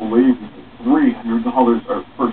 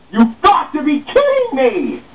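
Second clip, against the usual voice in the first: slow high exasperated cry. Acted. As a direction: rail at the bad luck. Victims after being robbed.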